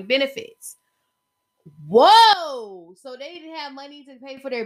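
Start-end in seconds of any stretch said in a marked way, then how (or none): 1.9-2.9 loud, drawn-out whoa; shocked reaction. Real, not acted. Podcaster reacting to staff losing benefits, shocked. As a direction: burst out in amazement. Reacting to lawsuit claim read out.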